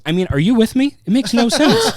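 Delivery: a director turning to his guest, loud disbelief; exasperated outburst. Retold or imitated. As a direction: throw it at the listener, demanding agreement. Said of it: "he goes".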